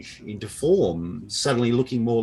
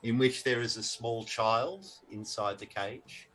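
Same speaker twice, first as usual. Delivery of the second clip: usual speaking voice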